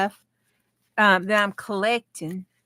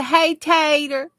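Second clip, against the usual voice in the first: Hey, Tater stretched slowly and lifted higher; drawn-out cheer; 8 semitones higher, 5 dB louder, slower than usual. Shown as a demonstration. Host leading chat in a slow, higher cheer of Hey, Tater. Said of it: "spelled like we say it".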